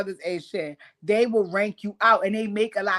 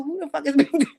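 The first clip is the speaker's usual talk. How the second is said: laughing; 5 semitones higher, faster than usual. Retold or imitated. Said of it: "I'm like".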